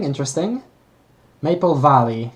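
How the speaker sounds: ordinary talk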